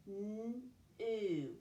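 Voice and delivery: "N-oo" stretched, a long hummed n then a long oo; sounded out. Shown as a demonstration. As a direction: sound out "N-oo" slowly, sound by sound. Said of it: "Let's sound out that first syllable".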